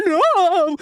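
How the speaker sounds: high, loud anguished "No!"; reliving the scream. Retold or imitated. Fan reliving his scream as Yuri drops, loud and high. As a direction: scream it like your fighter just went down. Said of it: "I immediately lost my voice".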